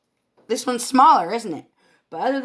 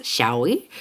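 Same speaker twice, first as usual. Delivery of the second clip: ordinary talk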